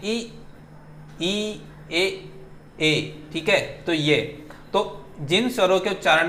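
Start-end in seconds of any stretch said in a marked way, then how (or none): 0.0-2.0 ई and ए drawn out, a bit softer; vowel demo. Shown as a demonstration. Teacher shows students the front vowels ई, ए, drawn out. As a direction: model ई then ए with an open mouth. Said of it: "आप मेरा जो है mouth देख सकते हैं".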